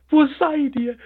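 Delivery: high, loud despairing cry; calling out in tears; desperate call. A narrator's reading. The hare cries out in despair for the dead flowers. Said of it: "rief er verzweifelt".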